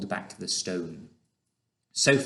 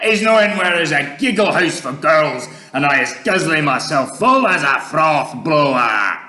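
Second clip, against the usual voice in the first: loud, higher gloating giant voice; greedy giant; 6 semitones higher, 11 dB louder than usual. Acted. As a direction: shout a hungry boast. Maidmasher shouting as he gallops.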